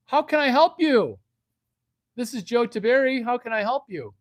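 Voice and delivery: high, loud salesman phone greeting; over-eager manager greeting. Acted. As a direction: answer the phone with hammed-up eagerness. Acting general manager answering a call.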